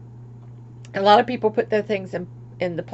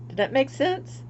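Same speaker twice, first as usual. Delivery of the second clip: usual speaking voice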